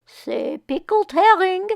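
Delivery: high, slow photographer voice; neighbor voice. Acted. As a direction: coax a smile out of the family, high and drawn out. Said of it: "said Mister Abramowitz".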